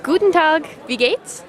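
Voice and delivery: fake German. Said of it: "imitate German based on their impression".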